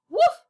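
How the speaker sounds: high, loud squeaky woof; hiccuping dog bark. Acted. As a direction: yelp it out. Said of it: "One of the things should work".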